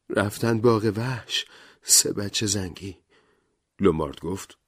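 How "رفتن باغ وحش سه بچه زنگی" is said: higher, slow rhyme recital; recited rhyme. Acted. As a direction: recite the line slowly in a character voice. (شعر را آهسته و زیرتر بخوان)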